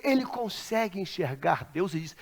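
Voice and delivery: usual speaking voice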